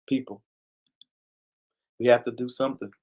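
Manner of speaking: ordinary talk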